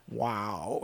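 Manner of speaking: low, soft catchphrase impression; Owen Wilson. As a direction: drop it quietly like a stunned movie star. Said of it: "He, he does a real good Owen Wilson".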